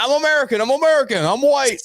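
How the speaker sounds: high-pitched, hammed-up pleading; exaggerated high protest; mock panicked plea. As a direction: plead your case in mock alarm. Pleading with guards, retold.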